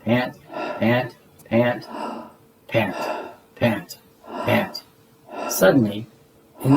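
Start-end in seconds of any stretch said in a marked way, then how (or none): none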